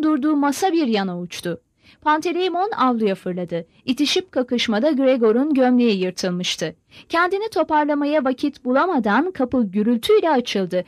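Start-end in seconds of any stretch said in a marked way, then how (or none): none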